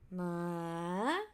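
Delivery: "ma" low, then sweeping up; rising tone. Shown as a demonstration. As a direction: climb from low on "ma". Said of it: "these are the five Thai tones".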